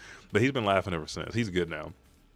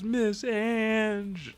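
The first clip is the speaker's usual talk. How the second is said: long, high mock wail; imitated tearful cry. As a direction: draw out the name like a crying girl. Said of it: "and starts crying".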